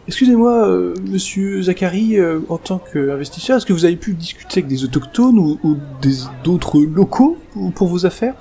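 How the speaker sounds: high-pitched reporter calling out; eager journalist. Acted. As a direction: interrupt politely but insistently like a keen reporter. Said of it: "un autre journaliste qui interpelle". (journaliste qui interpelle, voix aiguë)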